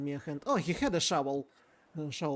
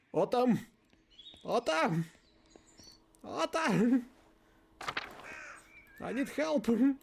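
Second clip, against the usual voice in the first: calling out; 8 semitones higher, slower than usual. Acted. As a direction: shout for him across the yard. Calling out for Otto.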